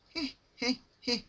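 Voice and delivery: high little giggles; giggling. Acted. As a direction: giggle down at her. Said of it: "Pinkie Pie giggling".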